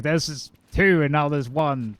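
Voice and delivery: slow, lightly voiced kids' TV count; Sesame Street counting. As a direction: count it out like a children's show host, lightly. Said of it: "this is an episode of Sesame Street".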